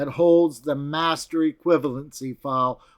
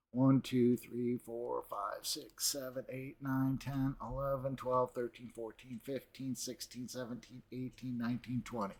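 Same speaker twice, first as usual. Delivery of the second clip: murmured counting, much softer; counting under breath; 3 semitones lower, 16 dB quieter than usual. Real, not acted. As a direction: mumble the count to yourself. Counting precincts under his breath.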